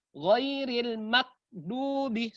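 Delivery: ghairil maghdubi with the gh held too long; slow maghdubi with a long pause on gh; wrong held stop. Shown as a demonstration. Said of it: "Harusnya jangan juga ditahan seperti ini". (bacaan salah, gh ditahan terlalu lama)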